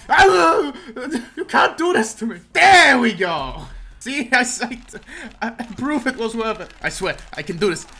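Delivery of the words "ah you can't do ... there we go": high, loud protest with a laugh in it; exasperated yelp turning triumphant; worked-up gamer outburst. Real, not acted. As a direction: cry out, then cheer your comeback. Struggling at button mashing.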